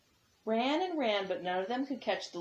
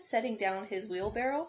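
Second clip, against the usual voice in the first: usual speaking voice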